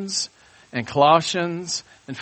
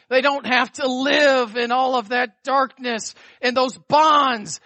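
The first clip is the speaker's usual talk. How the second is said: fervent preaching; 5 semitones higher than usual. Acted. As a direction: preach it out with fire. Preaching at full force.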